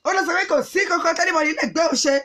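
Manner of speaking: ordinary delivery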